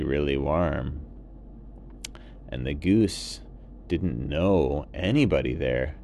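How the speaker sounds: ordinary delivery, nothing marked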